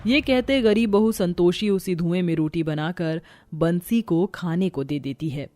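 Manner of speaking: usual speaking voice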